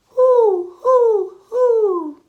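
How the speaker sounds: high loud hooting; owl hoots. Acted. Owl in the rhyme hooting at the children, loud and high. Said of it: "he flaps his wings and says".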